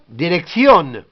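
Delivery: "dirección" as dee-rek-THYON, sounded final n; Spanish pronunciation. Shown as a demonstration. Teacher showing two Cs in Spanish, stressed -ción. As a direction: say "dirección" with stressed last syllable. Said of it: "en espagnol".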